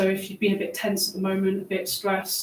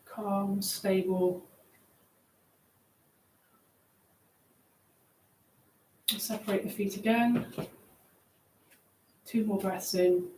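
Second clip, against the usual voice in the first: guided meditation; 5 dB quieter than usual. Acted. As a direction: ease them down gently. Leads a grounding meditation.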